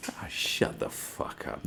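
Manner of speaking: slow, breathy whisper; muttered dismissal. Real, not acted. Brushing off the online haters in a whisper. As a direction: hiss it out under your breath.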